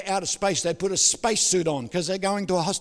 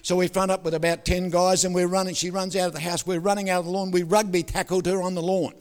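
ordinary talk, nothing marked

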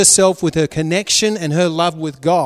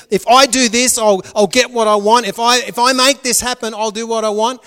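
louder, determined plotting; a wife planning to win her husband, louder; scheming Leah; 5 semitones higher, 5 dB louder than usual. Retold or imitated. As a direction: talk yourself into the plan, louder. Voicing Leah's scheming thoughts.

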